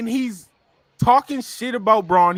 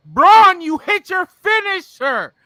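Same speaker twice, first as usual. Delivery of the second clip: exasperated yell; 7 semitones higher, 4 dB louder than usual. Real, not acted. Yelling at Braun again.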